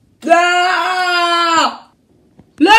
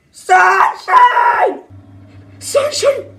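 high, stretched-out shout; desperate call; 11 semitones higher, much slower than usual. Acted. Nick shouts for Sunshine, desperate high call. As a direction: yell the name in alarm. Desperately calls her name.

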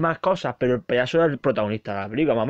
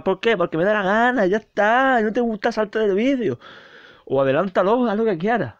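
cheeky defiant; 7 semitones higher than usual. Real, not acted. Defiant aside to viewers.

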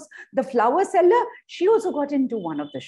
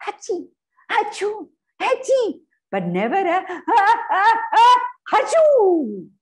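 sneeze sounds; 9 semitones higher, 5 dB louder than usual. Acted.

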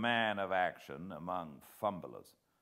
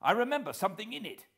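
fast, high, louder burst; excited rush; 14 semitones higher, 7 dB louder, much faster than usual. A narrator's reading. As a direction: spill it out in a hurry. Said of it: "I cried to Sir Walter".

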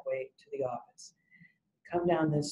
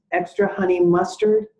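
"Extra honey mustard" echoed loud and higher, rising; repeating as a question; 4 semitones higher, 8 dB louder than usual. Retold or imitated. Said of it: "repeats what you say".

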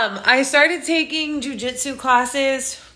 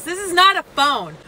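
normal talking voice

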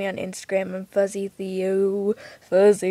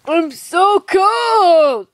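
mock shout; 6 semitones higher, 7 dB louder, much slower than usual.

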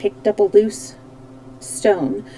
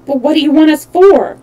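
louder, higher uneasy question; raised voice with a touch of worry; 6 semitones higher, 8 dB louder than usual. A narrator's reading. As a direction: ask with rising alarm. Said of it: "asked the Shaggy Man uneasily".

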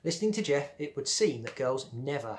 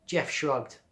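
plain reading voice

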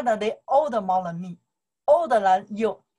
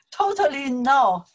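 loud, laughing, drawn-out no; amused, higher and louder denial; laughing refusal; 4 semitones higher, 7 dB louder, much slower than usual. Real, not acted. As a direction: reject it through a real laugh. Amused rejection of student's usage.

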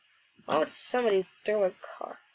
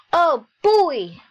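put-on high, loud "oh boy" cheer; excited exclamation; 7 semitones higher, 9 dB louder than usual. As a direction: burst out with mock excitement. Reading out character's exclamation.

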